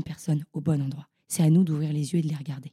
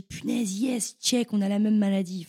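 retold excited cheer; 4 semitones higher than usual. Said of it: "je lève la main et je dis".

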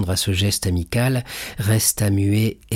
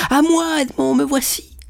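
high, ringing call to her love; joyful cry. A narrator's reading. Mercédès running to the door calling Edmond joyfully. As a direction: fling the words toward the door. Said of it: "en s'écriant".

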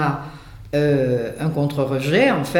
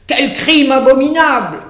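outraged cry; 8 semitones higher, 7 dB louder than usual. Acted. The animals' court condemning the donkey, outraged and loud. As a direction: cry out as if the crime were monstrous. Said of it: "Point d'exclamation".

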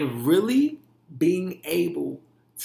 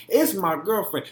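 ordinary talk, nothing marked

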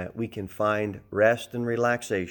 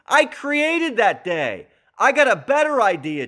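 high, loud proclamation in Jesus's voice; indignant Jesus; 10 semitones higher, 6 dB louder than usual. Retold or imitated. Preacher as Jesus claims he created that day, indignant. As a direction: assert authority as Jesus, pitched right up. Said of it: "Jesus said".

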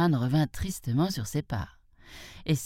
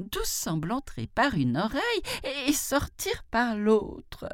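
high, lamenting jackal voice; sad jackal; 9 semitones higher than usual. A narrator's reading. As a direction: lament it high, slowing at the end. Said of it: "dit-il tristement". (chacal triste, voix haute et plaintive)

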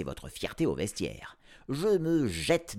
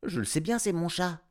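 put-on higher, insistent girl's voice; girl's protest; 9 semitones higher than usual. A narrator's reading. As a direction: insist it's her cat. Girl protests to the vet.

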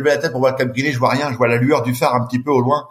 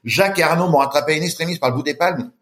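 ordinary talk, nothing marked

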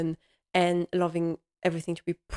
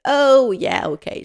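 mock surprise; 7 semitones higher, 7 dB louder than usual. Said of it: "She's like".

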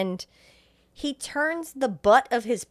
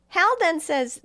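ordinary talk, nothing marked

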